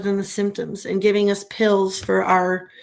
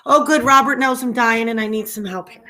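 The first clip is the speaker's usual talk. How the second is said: ordinary delivery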